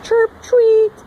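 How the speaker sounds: high, loud chirp and tweet; high loud birdlike chirp; chirping call. Shown as a demonstration. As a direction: chirp like a little bird. Frog call imitation.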